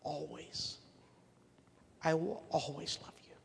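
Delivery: whispered I will always love you; tender whisper. Acted. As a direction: breathe it softly like a promise. Tender reassurance to child.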